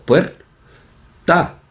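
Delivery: "puer, ta" as two separate beats; dos sílabas. Shown as a demonstration. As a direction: break it into "puer" and "ta", diphthong intact. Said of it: "dos sílabas". ("puer, ta" marcando dos sílabas)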